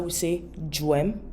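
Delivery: ordinary delivery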